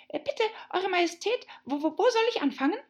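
high, hurried and stammering; a flustered little rabbit, tripping over words. Acted.

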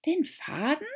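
high puzzled question; puzzled Alice. A narrator's reading. Alice to the mouse, high and puzzled. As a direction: look around for the thread. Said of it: "fragte Alice".

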